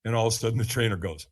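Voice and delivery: ordinary delivery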